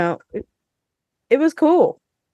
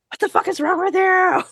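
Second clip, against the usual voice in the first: high, exasperated mock yell at a character; mock rant; 13 semitones higher than usual. Listener retelling her shower rant at Jeremy, high mock yell. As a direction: rant at Jeremy, half laughing. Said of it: "ranting in the shower at Jeremy".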